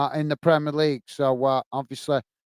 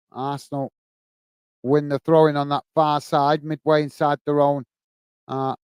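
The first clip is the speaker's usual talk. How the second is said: live play-by-play; 2 semitones lower than usual. Acted. Streamer calling the Luton Arsenal game for viewers, play-by-play. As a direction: call the match as it unfolds.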